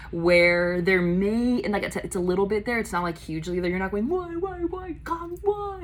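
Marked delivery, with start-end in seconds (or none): 4.1-5.8 high, drawn-out theatrical lament; hammed-up plea to the heavens; mock dramatic wail. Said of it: "not, like, in this dramatic space".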